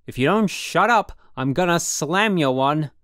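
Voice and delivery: he's losing it, bellow the warning; furious yell. A narrator's reading. Roommate losing his temper at Holden, furious yell. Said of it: "I really had him going".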